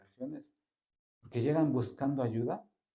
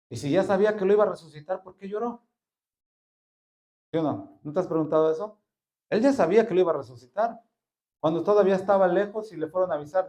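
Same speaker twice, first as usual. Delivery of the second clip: fast, loud, high preaching; fervent rising sermon delivery; preaching at full force; 6 semitones higher, 7 dB louder, faster than usual. Real, not acted. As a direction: drive the point home to the congregation.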